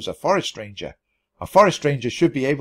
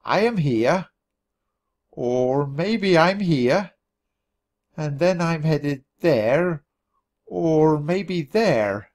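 puzzled muttering; 2 semitones higher, much slower than usual. A narrator's reading. Puzzling over the map alone.

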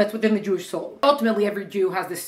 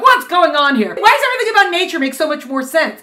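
higher and louder, hammed-up bafflement; mock confusion; 7 semitones higher, 6 dB louder than usual. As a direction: throw up your hands at the puzzle. Imitating a baffled person.

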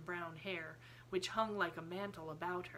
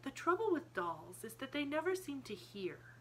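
put-on high, pensive child's voice; thoughtful child, pitched well up; reflective little girl; 8 semitones higher than usual. A narrator's reading. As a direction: muse aloud as a small girl working out a thought. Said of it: "reflected as she said it".

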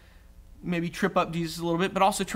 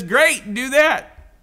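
bright, loud, quick go-ahead; upbeat approval; 7 semitones higher, 7 dB louder, much slower than usual. Retold or imitated. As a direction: cheer him on, higher and louder. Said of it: "And Jesus basically says".

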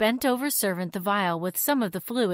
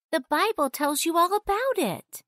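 put-on higher young girl voice; 9 semitones higher than usual. A narrator's reading. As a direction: voice Janet as a girl.

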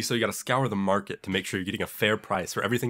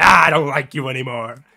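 louder, hammed-up brush-off; mock fed-up neighbor; 2 semitones higher, 8 dB louder than usual. Neighbor telling off the hedge, louder mock annoyance. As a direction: shove it away like a grumpy neighbor. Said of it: "He was just like".

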